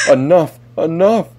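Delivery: high, loud cry, climbing on the repeat; exasperated shout. A narrator's reading. Narrator with splitting head silencing the crow, high desperate shout. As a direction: shout to silence the unbearable noise.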